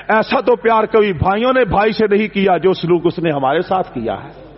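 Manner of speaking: ordinary talk, nothing marked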